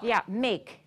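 ordinary delivery

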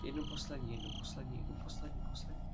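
soft, hushed pleading whisper; tense whisper. Real, not acted. Streamer hopes for the last page, tense whisper. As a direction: breathe the words like a wish.

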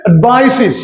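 অ্যাডভাইসেস ending in "-siz", falling; new "-iz" ending. Shown as a demonstration. Teacher showing the corrected way, অ্যাডভাইসেস with "-siz". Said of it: "কি হবে?".

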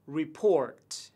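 "report" with PORT stressed; stress on second syllable. Shown as a demonstration. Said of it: "nhấn trọng âm vào âm tiết thứ hai". (nhấn vào âm "port" của "report")

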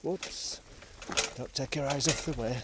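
ordinary talk, nothing marked

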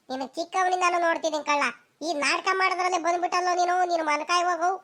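ordinary talk

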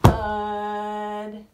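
heavy drawn-out thud; thud sound effect. Shown as a demonstration. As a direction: drop the word like a weight. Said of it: "here comes the thud part".

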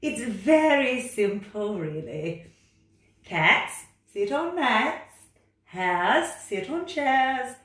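loud, high, bossy cat voice. Acted. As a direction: order the frog about like a know-it-all cat. Cat character lecturing.

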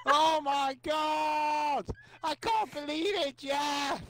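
stretched-out, disbelieving shout; slow, laughing commentator exclamations; elated goal celebration. Real, not acted. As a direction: draw out every word in joyful disbelief. Mock commentary celebrating late goal.

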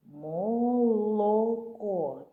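"Молоко" stretched very slowly, almost sung; slow sounding out. Shown as a demonstration. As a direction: draw out "Молоко" to catch the л. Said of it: "А звук «л»?". (дуже повільно, майже співуче)

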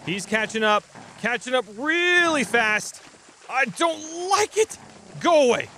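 rising panicked high voice; frantic cries while fleeing; being chased. Real, not acted. Enemy chasing player.

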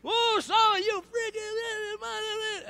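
high, loud, drawn-out mock curse; mock cussing yell. Driver hollering a curse out the window, high and stretched.

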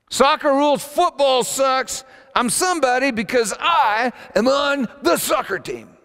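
high, loud re-enacted chant; boastful yelling. Preacher replaying his teenage boast about soccer, yelling. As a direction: shout it as a swaggering brag. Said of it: "We were yelling this".

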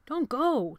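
high, loud plea; pleading. A narrator's reading. Tony begging Jonathan to stay, pleading. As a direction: beg him urgently to stay. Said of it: "Walter pleaded".

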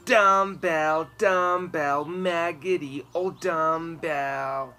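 loud jeering chant, slowing down; singsong taunt. A narrator's reading. Eric dancing around Trisha, loud jeering singsong.

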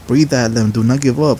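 ordinary talk, nothing marked

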